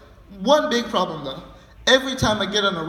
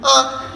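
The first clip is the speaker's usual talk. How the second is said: a little higher, louder mock scream; a milder scream; 4 semitones higher, 4 dB louder than usual. Mister Kent screaming again on the ride, mock scream. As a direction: scream again, a bit less. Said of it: "He's screaming".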